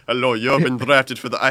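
put-on Russian officer voice; mock Russian accent. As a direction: bark orders in a bad Russian accent. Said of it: "Why is he Russian?".

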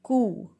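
"coo" with no final consonant; correct silent P. Shown as a demonstration. Teacher repeating coup for learners, coo without P. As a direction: say "coo", ending on a long oo. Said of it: "The pronunciation is".

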